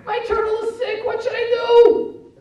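put-on high, loud child's wail; hammed-up child in a crisis; panicked child. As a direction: cry out for help, stretching the question. Acts child's panicked appeal to parent.

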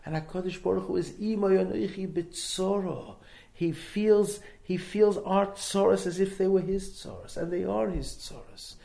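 ordinary talk, nothing marked

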